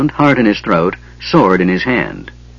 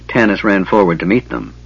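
ordinary delivery, nothing marked

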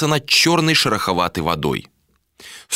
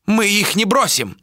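high, strained-out protest; hoarse protest; 13 semitones higher than usual. A narrator's reading. Elder refuses to abandon people, hoarse high protest. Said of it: "прохрипел старейшина".

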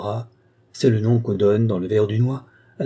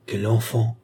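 ordinary delivery, nothing marked

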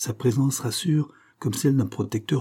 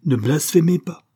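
loud, higher rebuke; outraged cry; 7 semitones higher, 6 dB louder than usual. A narrator's reading. Father outraged at his son's oath, crying out. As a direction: forbid it with indignation. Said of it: "s'écria monsieur Berthomy".

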